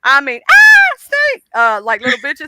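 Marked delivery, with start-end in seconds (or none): 0.5-1.4 very high, loud panicked shriek; shrill mock scream, far louder; mock terrified scream. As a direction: scream like a frightened runner seeing a snake.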